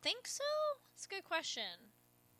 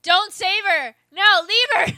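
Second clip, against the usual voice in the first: loud, higher shouted pleas; yelling at screen; 6 semitones higher, 12 dB louder than usual. Real, not acted. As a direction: shout at the characters.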